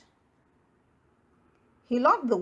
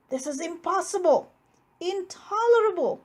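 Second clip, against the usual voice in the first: building higher and louder with each word; rising fury, word by word; furious outcry; 7 semitones higher, 3 dB louder than usual. A narrator's reading. Bear crying out in disbelief at the mouse, building anger. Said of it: "cried Bear".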